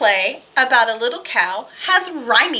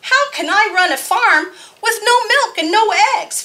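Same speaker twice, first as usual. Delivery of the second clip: furious farmer; 7 semitones higher than usual. Acted. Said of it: "Farmer Brown was furious".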